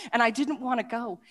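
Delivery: normal talking voice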